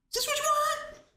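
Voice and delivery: hammed-up taunt, voice jumping up; mocking shout. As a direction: fling it at him, high-pitched. Acted confrontation, repeated.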